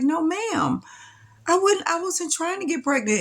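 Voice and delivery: high, earnest protest; earnest denial. Retold or imitated. Patient defending herself to the nurse, high earnest denial.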